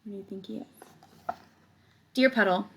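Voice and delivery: ordinary talk, nothing marked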